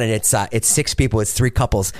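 ordinary talk, nothing marked

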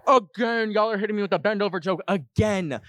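high mock-outraged cry; exasperated outburst. Host reacts to the repeated prank joke, exasperated cry.

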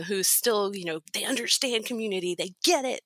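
ordinary talk

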